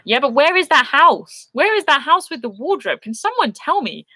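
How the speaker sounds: high-pitched, playful exasperation; comically urgent questioning; mock desperate plea. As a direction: beg to know, voice swooping way up high. Mock plea to find Narnia house.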